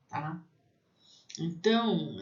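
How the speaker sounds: usual speaking voice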